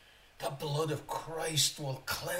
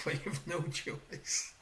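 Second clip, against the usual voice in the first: playful whisper; 5 dB quieter, slower than usual. Real, not acted. Teasing the listener, laughing.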